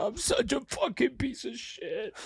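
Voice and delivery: high, slow, hammed-up weepy self-pity; mock sobbing. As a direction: whine it like fake tears after a bad joke. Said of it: "You're crying".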